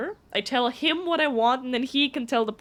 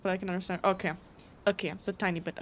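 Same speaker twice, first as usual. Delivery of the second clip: ordinary talk, nothing marked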